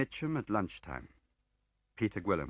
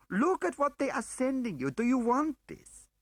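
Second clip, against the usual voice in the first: retold angry plea; 7 dB louder than usual. A narrator's reading. Woman reliving her protest to her husband, high and loud. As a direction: throw it back at her husband. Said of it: "I said to him".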